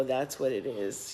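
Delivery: ordinary delivery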